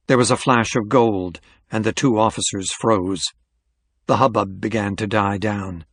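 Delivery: plain reading voice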